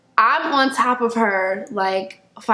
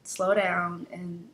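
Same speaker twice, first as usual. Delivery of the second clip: gentle advice; 2 semitones lower, 10 dB quieter, slower than usual. Real, not acted. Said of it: "To my younger self".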